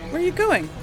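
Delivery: ordinary talk, nothing marked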